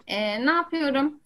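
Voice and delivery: ordinary talk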